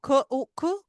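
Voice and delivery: ख, उ, ख separately, उ brief; short OO, sounded out. Shown as a demonstration. Teacher breaking cook into sounds, short उ. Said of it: "short ओ का sound आ रहा है".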